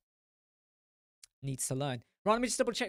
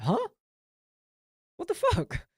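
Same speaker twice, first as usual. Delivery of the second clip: a high, stunned what the fuck; baffled outburst; 13 semitones higher than usual. Retold or imitated. Retelling his reaction to the accent comment, baffled and high. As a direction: pitch leaps way up in disbelief. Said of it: "I was like".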